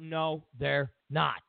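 ordinary talk, nothing marked

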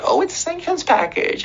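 high mocking voice of a smug official. Speaker mocking American officials' excuse, high mock voice. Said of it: "don't give me this shit that".

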